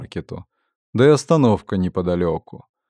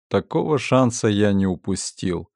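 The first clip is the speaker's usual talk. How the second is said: plain reading voice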